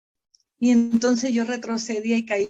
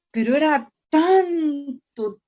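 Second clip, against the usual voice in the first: crying; 6 semitones higher, 5 dB louder, slower than usual. Real, not acted. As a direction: voice breaks into a real sob, higher and louder. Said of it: "tanta mi desesperación".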